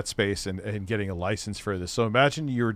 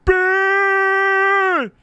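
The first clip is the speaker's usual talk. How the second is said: a huge, much higher yell of one word; Frankie McDonald shout; 10 dB louder than usual. Retold or imitated. Imitating a vlogger yelling at night, much higher and louder. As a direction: belt it out to the night street. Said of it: "And he's like".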